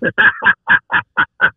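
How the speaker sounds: loud burst of laughter; big, high cackling laugh. Real, not acted. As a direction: laugh hard and loud at a friend's story. Laughing at the prank story.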